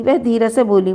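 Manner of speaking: ordinary delivery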